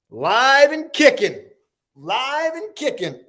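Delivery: high-pitched hype whoop of a greeting; high, excited live-show holler; excited hype call. Real, not acted. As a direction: call it out high to rally viewers. Hyping up the live audience.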